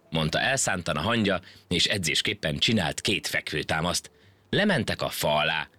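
usual speaking voice

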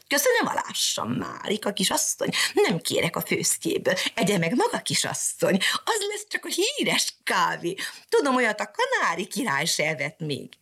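high, chirpy little bird voice teasing its mistress; mocking canary. Canary teases Mariska about her coffee, high mocking chirp. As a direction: pipe it up like a cheeky canary.

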